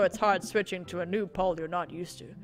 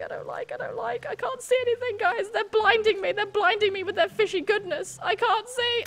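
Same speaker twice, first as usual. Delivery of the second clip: whiny panic; 11 semitones higher than usual. Real, not acted. Whiny panic at blinding fish.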